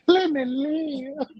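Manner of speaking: high, drawn-out mock echo. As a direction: stretch it out high after a laugh. Mockingly echoing co-host's tease.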